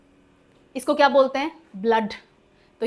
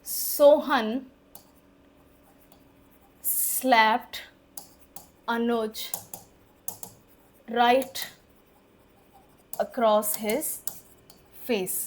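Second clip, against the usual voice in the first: example sentence spaced word by word with long pauses; slow dictation; 4 dB quieter, much slower than usual. Shown as a demonstration. Teacher reads the example sentence slowly, word by word. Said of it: "जैसे".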